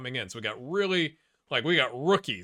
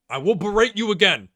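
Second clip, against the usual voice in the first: loud, raised-pitch threat; emphatic warning; 6 semitones higher, 10 dB louder than usual. Real, not acted. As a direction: repeat it louder to drive the threat home. Repeats threat to his players.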